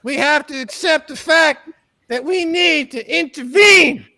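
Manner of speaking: rising sermon that builds to a shout; mock preaching. Mock preacher calling to bring Brother Elon back, building to a shout. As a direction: preach it like a revival pastor.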